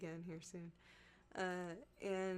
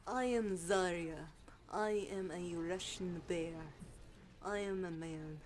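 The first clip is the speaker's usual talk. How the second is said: slow, hammed-up Russian strongwoman; slow, heavy mock-Russian voice; Zarya; 2 semitones lower than usual. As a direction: play a blunt Russian bear of a character. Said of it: "that sounds like Zarya".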